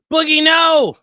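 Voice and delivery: sharp loud no at the dog; scolding the dog, loud; shouted scold. Real, not acted. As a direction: yell at the dog to stop.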